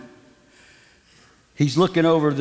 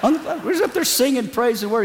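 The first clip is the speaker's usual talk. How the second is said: ordinary delivery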